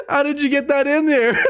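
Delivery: usual speaking voice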